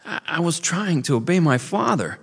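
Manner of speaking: put-on high, stammering boy near tears; tearful young beaver. A narrator's reading. Young beaver confessing to the old one, tearful and high. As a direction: plead through welling tears. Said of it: "his eyes grew moist".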